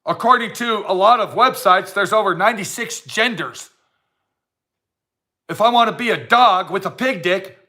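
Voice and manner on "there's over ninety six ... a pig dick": ordinary talk, nothing marked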